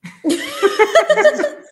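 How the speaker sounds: high laugh, then slow laughing words. Real, not acted. GM laughing at the joke.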